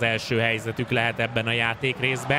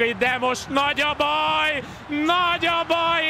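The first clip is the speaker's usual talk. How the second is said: alarmed commentary; 9 semitones higher than usual. Acted. Defence collapses, alarmed call.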